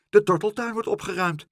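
high, loud and cheerful, pleased with the news. A narrator's reading. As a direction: brightly announce it like good news. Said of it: "zei mevrouw Helderder opgewekt".

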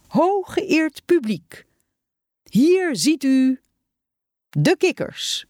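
grand, high-pitched, drawn-out ringmaster call; showman's announcement. A narrator's reading. Meneer Knibbe presents the frogs to his audience, showman's announcement. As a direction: announce it slowly with big dramatic pauses.

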